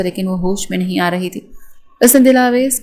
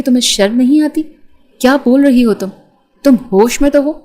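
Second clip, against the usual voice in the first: angry scolding; 4 semitones higher, 4 dB louder than usual. A narrator's reading. Mother scolds daughter after slap.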